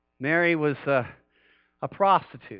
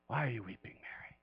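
hushed, tender whisper to a weeping woman; 6 semitones lower, 16 dB quieter than usual. Acted. Jesus gently asking weeping Mary, whispered. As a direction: breathe the question softly, almost unvoiced.

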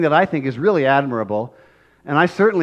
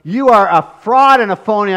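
angry accusation; 6 semitones higher, 7 dB louder than usual. Retold or imitated. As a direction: denounce him as a fraud. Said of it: "I'd have gotten angry".

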